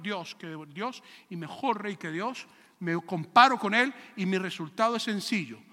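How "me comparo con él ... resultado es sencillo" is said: usual speaking voice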